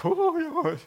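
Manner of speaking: high squeaky sheepish yelp; a startled dog's little yip; felt like a dog. Retold or imitated. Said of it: "I felt like a dog".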